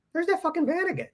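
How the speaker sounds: mock fed-up sigh; exasperated. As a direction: roll your eyes at that van. Second day seeing the van.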